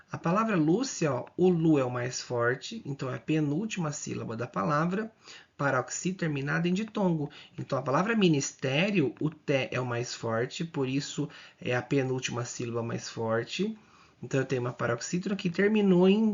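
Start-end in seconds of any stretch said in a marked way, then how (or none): none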